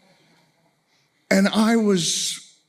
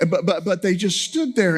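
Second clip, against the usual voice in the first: ordinary talk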